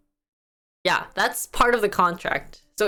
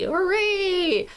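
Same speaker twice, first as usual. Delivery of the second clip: high cheer; cheerful hooray; 10 semitones higher than usual. Acted. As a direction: cheer it brightly. Cheering the topic.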